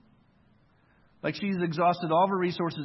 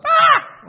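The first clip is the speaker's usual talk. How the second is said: a full loud, very high panicked scream; 14 dB louder than usual. Shown as a demonstration. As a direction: shriek like everything is falling apart. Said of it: "We get like".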